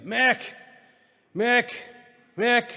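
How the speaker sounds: high, loud calls up the stairs; yelling for wife. Retold or imitated. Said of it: "he starts yelling for my mother".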